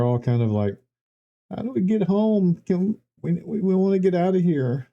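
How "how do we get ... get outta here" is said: lightly voiced lost villagers; confused villager voice. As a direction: raise the pitch a bit, bewildered.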